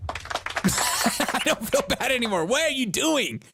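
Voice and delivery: high-pitched, through real laughter; laughing disbelief. Real, not acted. Reacting to the TikTok, laughing in disbelief. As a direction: laugh the words out, pitch jumping up. Cracking up at the clip.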